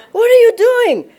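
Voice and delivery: high and loud, laughing; excited. Retold or imitated. Running up to a meditating man, asking him excitedly. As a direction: burst out the question with delighted excitement.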